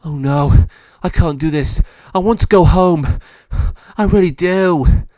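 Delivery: high, loud panicked pleading; a scared dog crying out to go home; loud frightened wail. A narrator's reading. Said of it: "Monty gasped and said".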